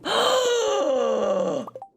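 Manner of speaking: drawn-out high gasp falling in pitch; long high gasp. Real, not acted. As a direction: gasp high and let it slide down. Sudden long high vocal sound.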